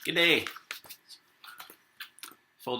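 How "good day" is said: loud, high Australian G'day; booming Australian G'day; Australian greeting. Acted. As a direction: call out an Aussie hello, higher and louder. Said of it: "this book is also kind of an Australian".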